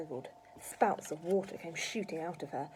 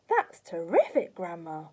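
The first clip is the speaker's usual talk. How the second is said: cried out; 8 dB louder than usual. A narrator's reading. George seeing Grandma on her feet, crying out. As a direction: cry it out in admiration. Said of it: "George cried".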